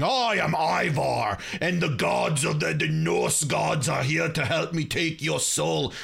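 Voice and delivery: slow, drawn-out mock Viking warrior; Norse warrior voice. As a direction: proclaim it like a soul-taking Norse warrior. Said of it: "'cause I was expecting".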